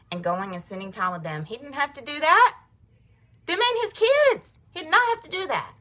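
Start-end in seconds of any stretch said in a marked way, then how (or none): 1.4-5.7 emphatic outburst. Real, not acted. Praising husband who still cared for her kids, loud emphatic outburst. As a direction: insist he owed them nothing, voice shooting up.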